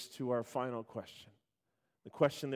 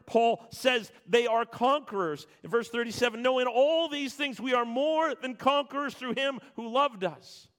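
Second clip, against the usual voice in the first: loud, high, driving sermon voice; full-force preaching; 7 semitones higher, 8 dB louder than usual. Acted. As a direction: preach it out to the whole church.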